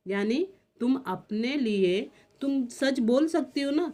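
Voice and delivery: ordinary talk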